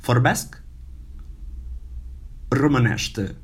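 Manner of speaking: vorbesc romanește, rising then falling; Romanian phrase. Shown as a demonstration. Teacher models vorbesc romanește for students. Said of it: "I speak Romanian".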